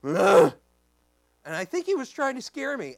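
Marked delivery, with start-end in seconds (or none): 0.0-0.5 short loud bear growl; imitated bear huff. Bear trying to scare the preacher, loud huff. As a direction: burst out like a bear scaring someone. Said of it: "and he goes".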